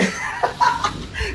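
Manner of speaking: airy, high mocking giggle; soft, high breathy laugh. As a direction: laugh at the old woman. Partner laughs at the elder.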